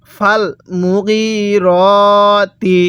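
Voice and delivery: মুগিরতি drawn out on long "ee" and "o" vowels; slow Quran recitation. Shown as a demonstration. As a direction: chant it very slowly, holding each long vowel. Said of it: "তাহলে কিভাবে পড়ব?".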